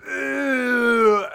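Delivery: long, loud, high "ugh"; drawn-out mock grunt of effort; imitated thrusting grunt. Imitating Raj's hip thrust after an error, loud drawn-out grunt. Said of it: "And he will just go".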